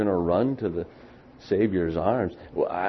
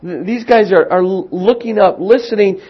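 much higher and louder, building excitement; preaching at full force; 11 semitones higher, 11 dB louder than usual. Acted.

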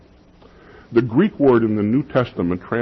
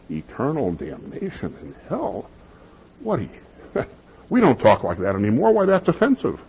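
mock scandalized objector; 3 semitones higher, 3 dB quieter than usual. Preacher mimics listeners offended by talk of hell, mock scandalized. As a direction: imitate someone taken aback and offended.

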